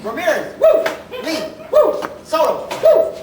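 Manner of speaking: fan whoop. Acted. Superfan cheers player names.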